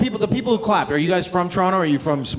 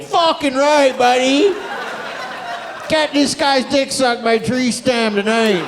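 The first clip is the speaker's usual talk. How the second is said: rowdy cheer; 6 semitones higher than usual. Retold or imitated. Rowdy friend hyping the eighteen-year-old, bro cheer. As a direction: hype him up like a party bro. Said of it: "You're like".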